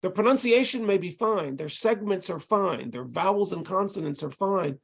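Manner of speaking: normal talking voice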